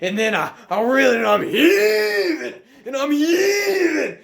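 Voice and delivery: high, very loud straining yell; full-blast frantic storytelling; excited shouting. Retold or imitated. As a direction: holler the climax, stretching the key words. Excited climax of fish fight.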